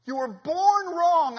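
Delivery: loud, high and very slow, leaning on born; slow preached cry. Acted. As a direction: drag each word out like a verdict. Preaching climax, slowed.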